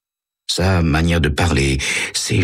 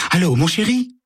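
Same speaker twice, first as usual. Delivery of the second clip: much higher, honeyed phone greeting; unrecognisable sweet voice; 14 semitones higher than usual. A narrator's reading. Carole coos to her lover on the phone, high and sugary. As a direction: purr it like a different woman. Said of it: "d'une voix que Sam a du mal à reconnaître".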